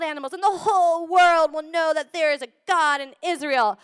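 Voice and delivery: put-on loud, rising excited declaration like a fearless kid; a boy shouting down a giant, sure of victory; childlike bold boast. Shown as a demonstration. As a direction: proclaim it like a kid who knows nothing's impossible. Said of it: "read this kind of, like a kid".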